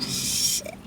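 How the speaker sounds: voiceless "oh no" moment retold; whispered curse. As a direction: whisper it under your breath in dread. Retold own dread after mother's reaction.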